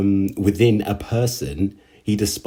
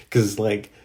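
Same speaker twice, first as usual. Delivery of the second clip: ordinary delivery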